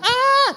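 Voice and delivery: short high mock scream of a scared child; child's scream. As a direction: yelp like a toddler seeing the needle. (teriakan anak kecil ketakutan)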